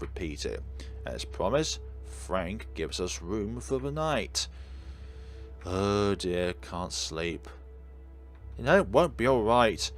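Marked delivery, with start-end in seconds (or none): none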